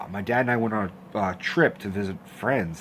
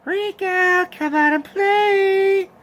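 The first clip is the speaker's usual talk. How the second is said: very high, slow, eerie singsong; creepy doll voice; 6 dB louder, much slower than usual. Retold or imitated. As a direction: lure the kid out like a killer doll. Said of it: "my brother would walk by the bedroom and go".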